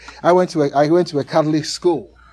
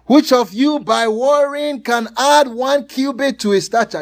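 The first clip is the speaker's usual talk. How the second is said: scripture reading; 8 semitones higher, 5 dB louder than usual. Acted.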